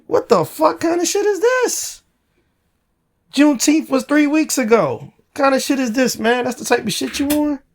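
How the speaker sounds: high-pitched exasperated outburst; real incredulous complaint; indignant. Real, not acted. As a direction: let the outrage push your voice way up. Indignant rant at being ignored.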